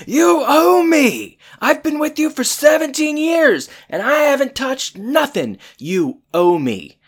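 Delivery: high, loud hammed-up rant, easing at the end; imitated screaming. Corey demands what Topanga owes him, mock screaming. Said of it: "screaming at her, like".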